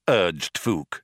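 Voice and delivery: plain reading voice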